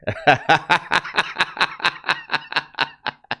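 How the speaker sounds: long run of high chuckles; real amused laugh. Real, not acted. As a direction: laugh helplessly at your own story. Amused by the captain's remark.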